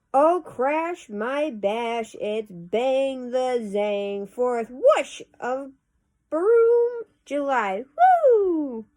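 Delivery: loud, slow, high-flying sound words; exuberant poem recitation. Acted. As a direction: act out the bangs and whooshes with drawn-out whoops. Performs a noisy Fourth poem.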